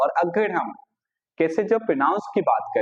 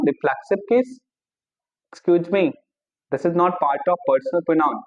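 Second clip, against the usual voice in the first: objecting student; 2 semitones higher, much slower than usual. Acted. Teacher voices a pupil objecting to the lesson, mock indignant. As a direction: object like a know-it-all pupil.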